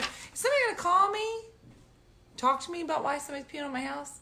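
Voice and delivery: high, fast retold indignation; indignant challenge. Homeowner retelling her challenge over the bill, indignant. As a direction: fire off the question high and quick.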